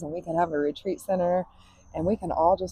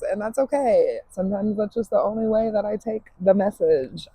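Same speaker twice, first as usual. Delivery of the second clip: ordinary talk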